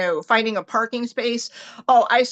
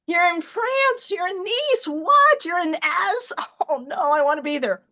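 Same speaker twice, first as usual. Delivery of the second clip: envious excitement; 6 semitones higher than usual. Retold or imitated. Replying to a friend's trip photo, high envious squeal. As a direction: gush with jealous excitement over a far-off trip.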